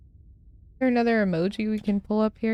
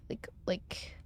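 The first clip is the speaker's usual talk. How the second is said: whispering; 16 dB quieter than usual. Real, not acted. Fiddling with the layout.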